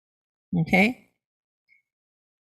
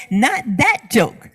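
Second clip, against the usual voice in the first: ordinary talk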